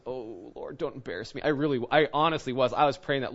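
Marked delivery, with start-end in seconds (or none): 0.0-1.4 soft, low plea under the breath; quietly beg, as if only God hears; retold private prayer. Retold private anxious inner prayer.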